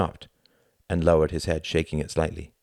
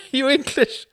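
laughing; 7 dB louder than usual. A narrator's reading. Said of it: "laughed Klaus".

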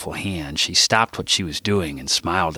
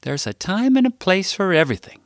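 lightly voiced mother, raised higher; mom's saying; 12 semitones higher than usual. Narrator quotes his mother's old saying, motherly. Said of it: "My mom would always say".

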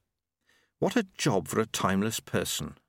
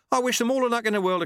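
forced cheer; 13 semitones higher, 4 dB louder, faster than usual. Acted. Forced good wishes.

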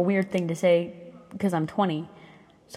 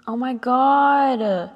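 slow, louder, higher oh my God; drawn-out dismay; 7 semitones higher, 6 dB louder, much slower than usual. Real, not acted. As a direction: stretch it out in shock. Sudden dismay at ripped nail.

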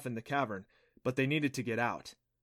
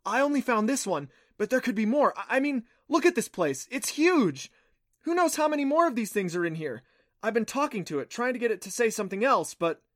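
high, loud, bubbling excitement; thrilled; 10 semitones higher, 6 dB louder than usual. Acted. Clancy showing Adam the huge cavern, high and thrilled. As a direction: gush about the discovery in a raised voice.

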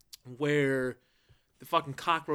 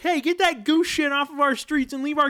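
mock angry parent; 9 semitones higher, 4 dB louder than usual. Voicing angry movie parents.

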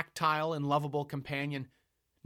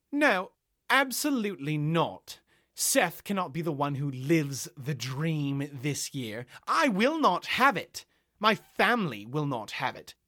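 furious protest; 5 semitones higher, 7 dB louder than usual. Acted. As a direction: refuse outright, building to high outrage. Chloe furiously objecting.

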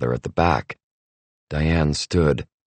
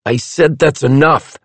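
loud, firm order to stop; angry; 10 semitones higher, 5 dB louder than usual. A narrator's reading. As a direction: shut it down sternly. Hopper cutting her off.

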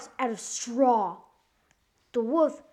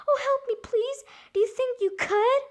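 very high, squeaky plea; high-pitched storybook pig pleading; crying pig; 12 semitones higher, 4 dB louder than usual. A narrator's reading. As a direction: beg for help as the piglet. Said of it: "Pig cried".

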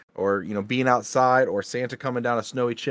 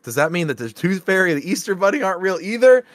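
put-on outraged kid question; 8 semitones higher, 6 dB louder than usual. Kid questioning mom about Santa.

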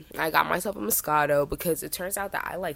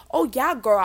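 flamboyant guy; 7 semitones higher, 5 dB louder than usual. Retold or imitated. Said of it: "definitely extremely flamboyant".

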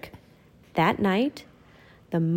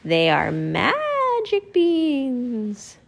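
put-on very slow, secretive old woman voice; old woman revealing a secret; mysterious old woman; 4 semitones higher, much slower than usual. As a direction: draw out the magic. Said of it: "said the old woman".

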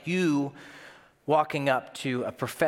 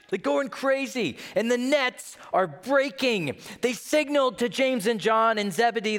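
excited storytelling; 10 semitones higher than usual. A narrator's reading. Preacher retelling the great catch, excited. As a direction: keep the amazement going.

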